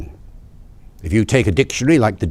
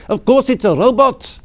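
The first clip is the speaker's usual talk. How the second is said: imitating the driver in a high, louder South African accent; 11 semitones higher, 4 dB louder than usual. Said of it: "in a lovely South African accent". (menirukan sopir dengan aksen Afrika Selatan, nada tinggi)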